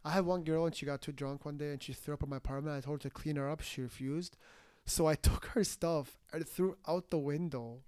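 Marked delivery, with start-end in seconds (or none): 4.9-7.8 reading the quote while breaking into laughter; can't keep a straight face, laughing between words; laughing through. Real, not acted. Reading Andrew Tate's quote to friends, laughing. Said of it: "I don't know why I'm laughing".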